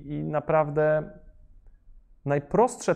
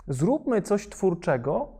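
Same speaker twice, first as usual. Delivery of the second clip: higher, louder mock voice of a cautious institution; mock conformist thinking; 5 semitones higher, 3 dB louder than usual. Mocking the institutions he worked against, raised and ironic. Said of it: "nie było jakiegoś takiego myślenia".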